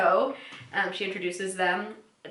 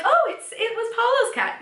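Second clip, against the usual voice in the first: much higher, gushing Rachel; imitating Rachel; 4 dB louder than usual. Host voicing Rachel swooning over Paolo, much higher. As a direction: swoon a little, pitched way up. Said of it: "And Rachel's like".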